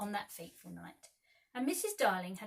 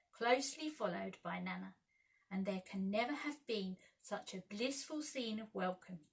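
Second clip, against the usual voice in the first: ordinary delivery, nothing marked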